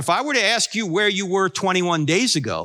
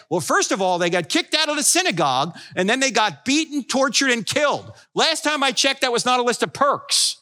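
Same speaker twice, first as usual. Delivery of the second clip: normal talking voice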